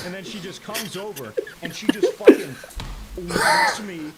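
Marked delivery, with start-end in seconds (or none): 1.9-4.1 laughing. Real, not acted. Laughing at belt whack memory.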